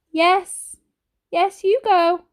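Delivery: a frail granny putting on a light tone for her family; light, croaky granny. A narrator's reading. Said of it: "with lightness in her voice".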